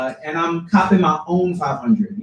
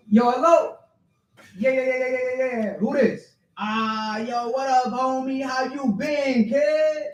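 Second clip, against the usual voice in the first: barber on phone; 9 semitones higher than usual. Acted. Barber answers poet's call, hammed-up high greeting. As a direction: put on a lively barber picking up a call.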